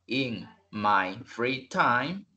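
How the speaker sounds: each word of "In my free time" drawn out slowly; slow, deliberate. Shown as a demonstration. Teacher slowing down for the student. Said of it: "vamos despacio".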